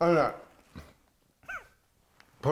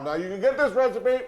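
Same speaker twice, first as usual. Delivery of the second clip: mouth full; 7 semitones higher than usual. Real, not acted. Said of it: "my mouth is full".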